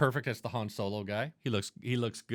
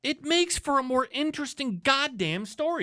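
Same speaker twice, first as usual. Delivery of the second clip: much higher, exasperated insistence; heated insistence; 13 semitones higher than usual. Real, not acted. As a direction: drive the point home, voice climbing. Heated argument about the movie.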